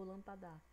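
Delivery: lampadar stressed on final DA; wrongly stressed lampadar, lam-pa-DÁ; stress on last syllable. Shown as a demonstration. Teacher repeating a wrong option, lampadar stressed at the end. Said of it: "Vocês observaram a diferença?".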